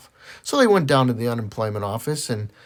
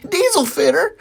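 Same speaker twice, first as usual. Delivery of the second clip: mock muffled voice; 6 dB louder than usual. Punchline voicing the friend.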